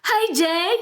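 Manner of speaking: high, loud, gushing hello; excited greeting. Retold or imitated. Woman greets her crush after five years, excited. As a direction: call it out with great excitement. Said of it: "with a lot of excitement".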